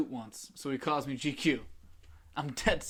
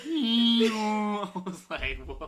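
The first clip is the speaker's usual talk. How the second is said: whale sound; 8 semitones higher, 10 dB louder, much slower than usual. Retold or imitated. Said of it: "Remember Dory's whale sound".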